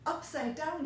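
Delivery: usual speaking voice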